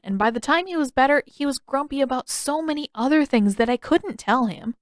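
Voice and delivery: put-on high, earnest boyish voice; young Aang explaining. Aang confessing his capture to Katara, high and earnest. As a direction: rush through the confession.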